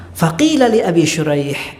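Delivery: ordinary delivery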